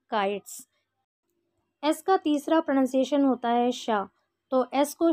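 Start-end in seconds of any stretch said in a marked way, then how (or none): 0.1-0.7 काइट्स ending in a plain s, "kaits"; teacher modelling काइट्स with a crisp s; correct pronunciation. Shown as a demonstration. Teacher showing students the right sound, careful pronunciation. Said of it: "सही pronunciation होगा".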